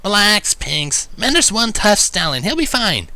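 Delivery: steady your friend; calm assurance. A narrator's reading. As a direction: Rainbow reassuring Pinkie, calm. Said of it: "Rainbow assured calmly".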